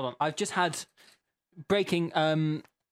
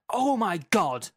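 slow, raised, mock-shouted outburst; all-caps exclamation; 5 semitones higher, 5 dB louder, slower than usual. Host starting an all-caps listener message, slow mock shout. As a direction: exclaim it big and drawn out in capitals. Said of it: "this again in all caps".

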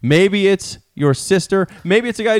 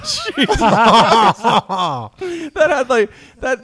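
laughing; 6 semitones higher than usual. Real, not acted. Reacting to terrible accent.

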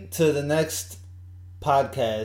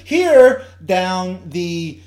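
loud, high weather report; weatherman voice; 6 semitones higher, 9 dB louder, slower than usual. Pretend. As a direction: point out the map like a forecaster. Mock weather map of a face.

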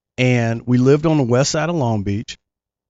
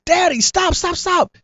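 put-on much higher, frantic little boy pleading; panicked kid. Young boy begging dad to stop the gas, much higher and frantic. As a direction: squeal it like a scared kid.

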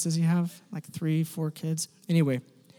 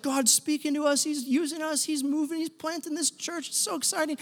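high, light, eager chatter; retold excitement; 8 semitones higher, 4 dB quieter than usual. Church planters thrilled that God is using them, giddy and high. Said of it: "we're all excited".

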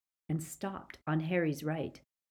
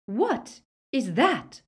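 slow, high demanding question; imperious woman, each word weighted; raised, deliberate voice; Bellatrix; 8 semitones higher, 5 dB louder, slower than usual. A narrator's reading. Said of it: "he heard her say".

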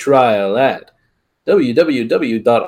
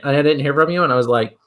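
ordinary talk

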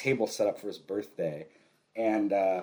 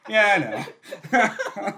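laughing through it; 9 semitones higher, 6 dB louder, much slower than usual. Retold or imitated. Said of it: "And he was like".

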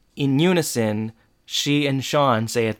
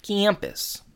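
high, falling pitch on "Campus"; playful repeat; 8 semitones higher, 5 dB quieter than usual. Shown as a demonstration. Podcaster toying with the word, playfully high. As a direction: say "Campus" high, then drop it.

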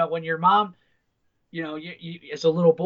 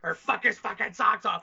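ordinary talk

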